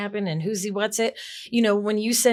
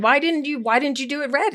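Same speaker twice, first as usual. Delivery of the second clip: a raised, exasperated why; mock exasperated boss; 7 semitones higher than usual. Exec scolding assistant over wall colour, mock exasperated. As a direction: complain like a puzzled boss, pitch lifted.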